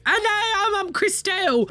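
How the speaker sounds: reacting to a tattoo, flustered high squeak; startled squeak. Real, not acted.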